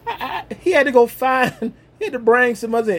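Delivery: high, amused, talking through laughter; real laugh running into the words. Real, not acted. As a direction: chuckle as you tell it. Laughs while telling his story.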